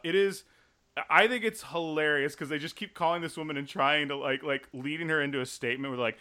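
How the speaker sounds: ordinary delivery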